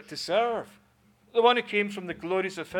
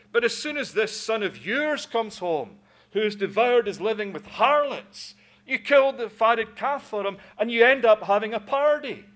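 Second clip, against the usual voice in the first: higher and louder, building to a yell; boiling-over rage; 5 semitones higher, 7 dB louder than usual. Acted. Son raging at his father over the party. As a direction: let the pent-up rage burst out. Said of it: "pent-up rage ... boiling point".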